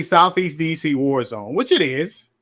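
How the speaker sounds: usual speaking voice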